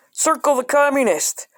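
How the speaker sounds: put-on cheery children's-book read; perky high instruction. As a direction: sell it like a cheery workbook. Mock quiz instruction.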